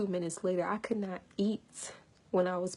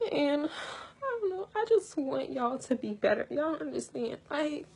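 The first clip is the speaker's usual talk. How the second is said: higher voice, close to crying; real tearful emotion; 6 semitones higher than usual. Real, not acted. Woman wanting better for her viewers, tearfully emotional. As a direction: plead through tears. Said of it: "I'm so emotional right now".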